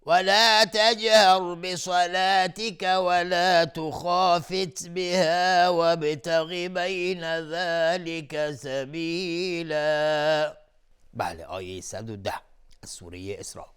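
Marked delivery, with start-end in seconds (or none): 0.1-10.6 slow, high, drawn-out tajwid chant; Quran recitation. Acted. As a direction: chant it slowly like a qari. Quran recitation after ta'awwudh. (تلاوت قرآن با صوت کشیده و آهسته)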